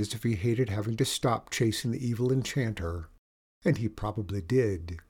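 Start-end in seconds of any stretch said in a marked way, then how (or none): none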